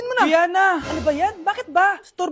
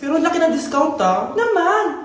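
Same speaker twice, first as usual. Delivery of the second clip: upbeat sponsored pitch, loud; ad read; 8 dB louder than usual. Acted. Friend pitches Grab saver fares to broke friend, loud ad read. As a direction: sell the Grab deal brightly.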